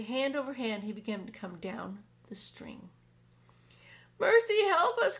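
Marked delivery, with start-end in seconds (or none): 4.2-5.2 high, loud panicked little bug voice; crying ladybug. Acted. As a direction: cry out in alarm like a tiny ladybug. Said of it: "cried the ladybug".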